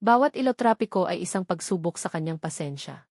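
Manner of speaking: usual speaking voice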